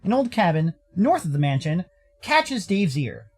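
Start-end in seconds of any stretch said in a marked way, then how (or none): none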